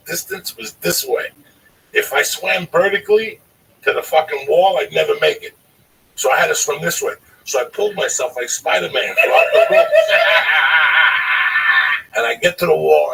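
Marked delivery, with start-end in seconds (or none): 1.9-9.9 rising to a laughing shout; loud climax, cracking into laughter; loud, building telling. Retold or imitated. Climax of pool story. 9.9-12.1 loud burst of laughter; loud shouting laugh. Real, not acted. Laughing after climax.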